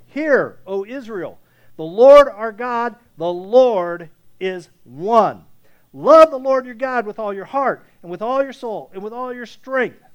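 loud, slow, proclaimed scripture; preaching at full force. Acted. Pastor proclaiming the Shema to the church, loud and slow. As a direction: thunder out the Shema to the congregation.

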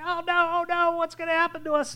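high, loud frantic cry; panicking disciples. Retold or imitated. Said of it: "the disciples are all panicking".

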